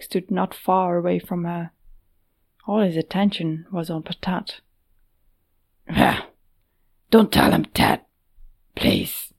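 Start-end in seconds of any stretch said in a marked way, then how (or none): 5.9-9.3 much lower, genuine pleading. Acted. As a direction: beg him sincerely in a low voice. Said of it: "Yodek sounded genuine in his pleading".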